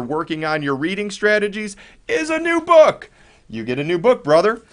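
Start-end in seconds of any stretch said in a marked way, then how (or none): 2.1-3.1 excited. Real, not acted. Announcing the book reward.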